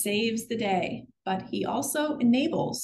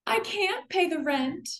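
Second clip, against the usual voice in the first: put-on high, pleading young widow; helpless victim voice; 7 semitones higher than usual. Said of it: "Into Savannah".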